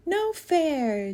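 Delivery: put-on high, complaining girl; whiny. A narrator's reading. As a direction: whine like a sulking kid. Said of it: "Jaya whined".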